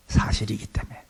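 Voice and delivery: soft whisper of the key point. Shown as a demonstration. Pastor whispering the reason to the congregation. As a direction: whisper 사실이기 때문에 like a secret.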